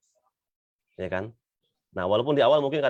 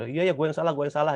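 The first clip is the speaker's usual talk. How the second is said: dismissive apology; 6 semitones higher, faster than usual. Retold or imitated. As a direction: brush it off, quick and annoyed. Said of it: "nggak nyadar salahnya di mana". (minta maaf dengan nada ketus, asal-asalan)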